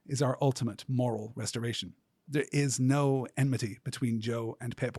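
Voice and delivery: ordinary delivery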